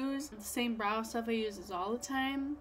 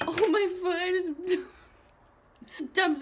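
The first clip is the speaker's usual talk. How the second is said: sudden loud higher yelp; startled; 5 semitones higher, 5 dB louder than usual. Real, not acted. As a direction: caught off guard. Startled by dropping.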